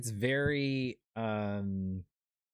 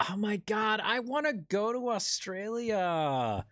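high, eager longing; excitedly wishing to visit Australia; 10 semitones higher than usual. Real, not acted. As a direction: gush about wanting to go. Excited wish, pitch up.

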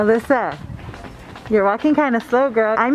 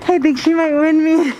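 excited; 6 semitones higher than usual. Real, not acted. Friend racing ahead fast.

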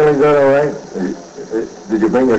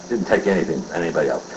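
rushed aside; 7 semitones lower, 7 dB quieter, faster than usual. Acted. Quick guilty aside.

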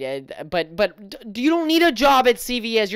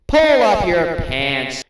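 drawn out, louder scolding; stern parent dragging each word; slow stern order; 3 semitones lower, 4 dB louder, much slower than usual. Acted. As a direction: lay it down slowly like a fed-up parent. Slow loud repeat.

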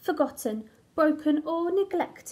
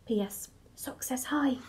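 whispered postscript; 3 semitones lower, 6 dB quieter than usual. Acted. Crayon passes on the sock's greeting.

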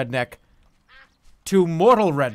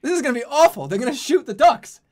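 high anxious whine; 10 semitones higher, 8 dB louder than usual. Real, not acted. Dreading the duck hunt.

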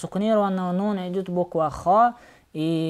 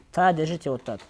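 normal talking voice

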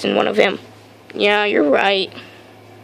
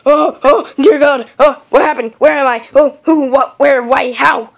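louder, higher, flustered questions; startled awake; 3 semitones higher, 4 dB louder than usual. Acted. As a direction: wake with a start, disoriented. Wakes up disoriented, startled.

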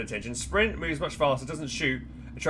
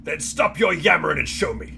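drill sergeant; 3 semitones higher, 8 dB louder than usual. Acted. Drill sergeant barking order.

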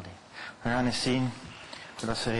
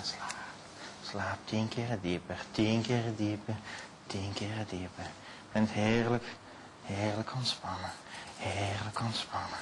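slow, soothing hypnotist voice sinking them deeper; hypnotic induction; 3 semitones lower, 7 dB quieter, slower than usual. Acted. Hypnotist to volunteers on stage, slow soothing induction. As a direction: lull the subject down into trance.

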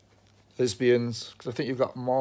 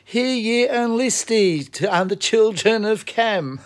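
mock solemn proclamation, much higher; imitating the recording; 9 semitones higher, 7 dB louder than usual. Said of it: "go through that to see".

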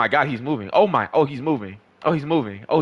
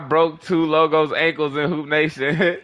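ordinary talk, nothing marked

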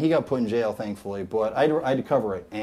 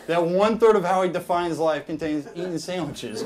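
normal talking voice

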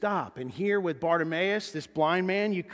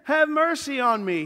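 high, loud, insistent shout; desperate plea shouted at full pitch; crying out all the more; 11 semitones higher, 7 dB louder than usual. Acted. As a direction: cry out to be noticed over everyone. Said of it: "kept crying out all the more".

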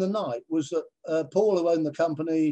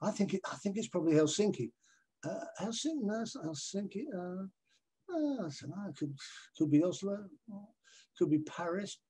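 dithering contestant; 3 semitones higher, 9 dB quieter than usual. Retold or imitated. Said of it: "So you go".

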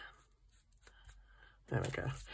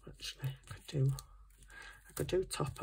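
whispering; 9 dB quieter than usual. Real, not acted. Muttering to herself while crafting.

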